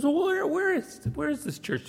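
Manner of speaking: high, quick tumbling questions; suddenly keen to know where; eager surprise. Retold or imitated. Store owner asking Mrs Beale, high and eagerly surprised.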